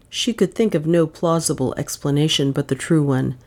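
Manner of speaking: ordinary delivery, nothing marked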